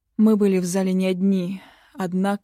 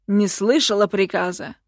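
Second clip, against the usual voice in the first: loud, menacing question; irritated imperial demand; contemptuous; 6 dB louder than usual. A narrator's reading. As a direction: push it out as a cold reprimand. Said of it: "процедил он".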